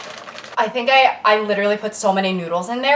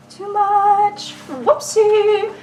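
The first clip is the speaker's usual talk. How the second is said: high playful squeak; 11 semitones higher, much slower than usual. Acted. Spilling sauce, playful.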